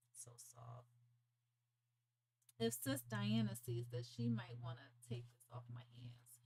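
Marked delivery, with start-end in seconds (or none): 0.1-0.9 breathy whisper. Real, not acted. Whispering over the yarn. 4.1-6.4 soft whispered aside; whispering. Real, not acted. Whispered aside about Sis Diana.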